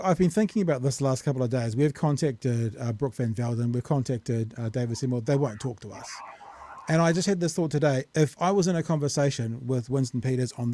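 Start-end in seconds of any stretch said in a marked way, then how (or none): none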